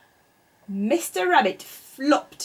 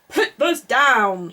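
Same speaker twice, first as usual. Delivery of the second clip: slow, louder warning shout; 2 semitones higher, 8 dB louder, slower than usual. A narrator's reading. Mister Rabbit shouting at the duckling to put things down. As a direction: call it out sharply and slowly. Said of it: "shouted Mister Rabbit".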